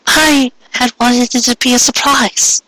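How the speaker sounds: high voiced wife, slightly strained; mare's voice, a little strained. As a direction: hold back a catch in the voice. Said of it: "her voice slightly trained".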